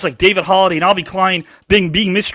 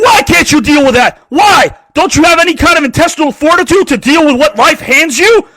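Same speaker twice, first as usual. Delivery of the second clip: loud, high mock-scolding boss; hammed-up angry boss; grumpy boss; 8 semitones higher, 6 dB louder than usual. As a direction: bark back like an irritated boss. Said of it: "And he goes to you".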